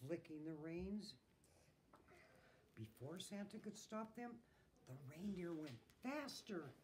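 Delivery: plain reading voice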